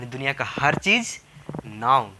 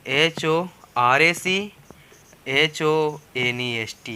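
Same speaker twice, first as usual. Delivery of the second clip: letters of horse and honest said slowly one by one; slow spelling drill of horse and honest; spelled out slowly; much slower than usual. Shown as a demonstration. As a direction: spell both words letter by letter for the class. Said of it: "हम पहले आपको लेते हैं horse और honest".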